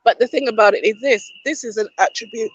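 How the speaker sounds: raspy, voice giving out; hoarse. Real, not acted. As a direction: push through a failing voice. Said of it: "My voice has come back".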